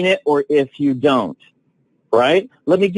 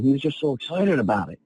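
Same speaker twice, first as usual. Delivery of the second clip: ordinary talk, nothing marked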